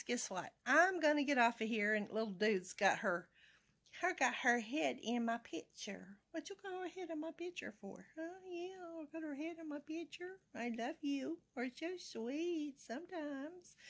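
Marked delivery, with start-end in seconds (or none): 6.3-13.8 high, soft cooing pet voice. Real, not acted. Asking the pet why its head is in the picture, soft high baby talk. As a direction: coo tenderly at the little one.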